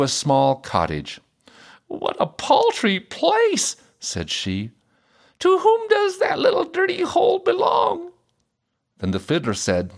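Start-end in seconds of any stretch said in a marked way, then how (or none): 1.9-3.8 slow, high-pitched disdain; disdainful princess. A narrator's reading. Princess arriving at a small cottage, disdainful. 5.4-8.1 very high, snooty princess voice; disdainful princess. A narrator's reading. Princess asking about the fiddler's cottage, disdainful.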